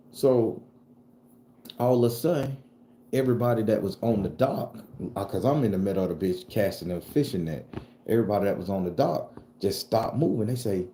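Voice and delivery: ordinary delivery, nothing marked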